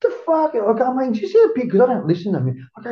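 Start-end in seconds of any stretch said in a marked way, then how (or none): 0.0-0.5 stunned disbelief. Retold or imitated. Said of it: "I'm just kinda going". 1.1-1.7 amazed disbelief. Retold or imitated. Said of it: "I'm just kinda going".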